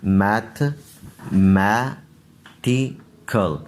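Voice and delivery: मैथमेटिकल stretched out syllable by syllable; drawn out so each part is heard; very slow model pronunciation. Shown as a demonstration. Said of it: "पहले lesson वाली आवाज़ पढ़ेंगे". (बहुत धीरे, हर हिस्सा अलग)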